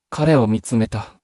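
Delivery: usual speaking voice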